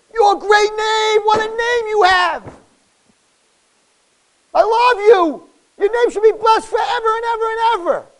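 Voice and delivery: high, loud cry of love; full-voiced, impassioned declaration; adoring outcry. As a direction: shout praise to someone you adore. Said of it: "imagine if you were saying that to someone you love".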